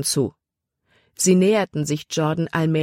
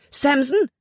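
high, urgent summons; commanding call; 9 semitones higher than usual. A narrator's reading. Libby orders her dog, urgent commanding call. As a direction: call the dog sharply to attention. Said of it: "befahl Libby".

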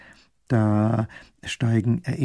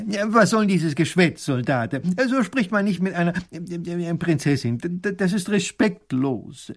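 higher-pitched scolding with flustered stammers; annoyed professor; 7 semitones higher than usual. A narrator's reading. Professor reprimanding the soldier for familiarity, annoyed. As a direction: scold indignantly, stumbling over the words. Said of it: "der Professor sagt ärgerlich".

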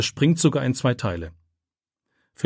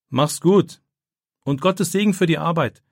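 calling after; 6 semitones higher, 3 dB louder than usual. A narrator's reading. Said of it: "ruft Torben ihm nach".

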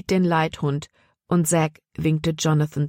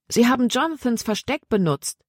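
Libby, high; 7 semitones higher than usual. A narrator's reading. Libby excited, voiced higher.

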